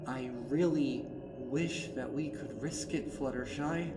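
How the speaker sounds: softened Twilight. Acted. Twilight answering Fluttershy, edge softening slightly. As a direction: ease the edge off, still firm. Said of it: "the sharp edge of her voice softening slightly".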